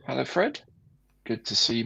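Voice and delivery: usual speaking voice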